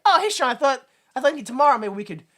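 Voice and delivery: high, loud mock-eager greeting; quick and perky, imitating an excited invitation; hammed-up friendly proposal; eager pitch. Imagining the eager pitch to Sean.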